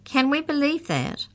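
high, incredulous question; disbelieving challenge. A narrator's reading. Maury doubts Mallory's story, incredulous. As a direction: question it with pitch way up.

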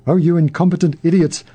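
plain reading voice

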